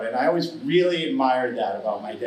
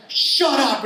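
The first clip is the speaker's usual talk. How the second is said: embarrassed snap; 12 semitones higher, 5 dB louder than usual. Retold or imitated. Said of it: "goes storming off".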